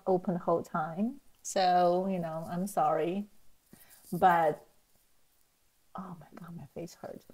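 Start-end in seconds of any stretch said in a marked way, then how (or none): 6.0-7.2 hushed aside; whispering. Real, not acted. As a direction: drop to a whisper. Aside after smiling the whole video.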